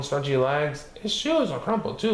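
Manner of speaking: plain reading voice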